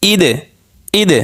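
ইদ with the final দ bounced out; qalqalah bounce. Shown as a demonstration. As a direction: jolt the closing দ of ইদ. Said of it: "এগুলো একটু ধাক্কা দিয়ে পড়তে হবে".

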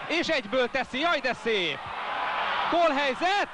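high, rising shout of a chance; excited commentary. Real, not acted. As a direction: cry out at the chance. Chance called in live commentary.

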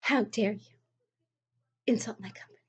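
soft, prissy condescending voice; mock offended churchgoer. Vlogger imitating a condescending religious seller, soft and prissy. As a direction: play a smug, offended believer quietly. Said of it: "religious person where they're like".

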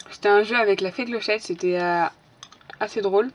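talking around a lollipop; words muffled by a lollipop; lollipop in mouth. Real, not acted. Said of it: "j'ai une sucette dans la bouche".

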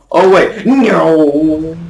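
high, loud wail with a drawn-out no; loud stretched yelp of dismay; dismayed cry. Real, not acted. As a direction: cry out as it all goes wrong. Caught out during game fight.

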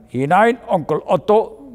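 höher und lauter, ausgelassen gerufen; fröhlicher Trinkruf. A narrator's reading. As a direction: ein beschwipster Prostruf in die Runde. Männer feiern beim Likörtrinken. (ausgelassen gerufen)

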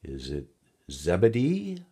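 girl's put-on questioning voice; feigned guessing. A narrator's reading. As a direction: guess with a big rising lilt. Girl makes a teasing guess.

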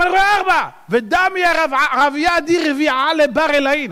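high-pitched excited proclamation; worked-up cry of astonishment; insistent amazement. Acted. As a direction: insist on it, voice pushed far up. Acted king insisting he sees four.

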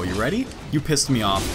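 usual speaking voice

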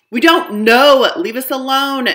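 loud, exasperated shout. Acted. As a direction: yell to shake off the pest. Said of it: "they shout".

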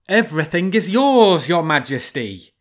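high, loud cry; cried out. A narrator's reading. Frightened fox crying out to the lion. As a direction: cry it out to appease the king. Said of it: "cried Renard".

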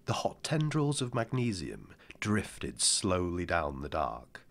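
ordinary delivery

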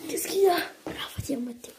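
normal talking voice

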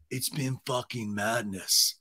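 low, slow, drawn-out weariness; mock worn-out bro; weary Schwartz. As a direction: let it drag out, exhausted.

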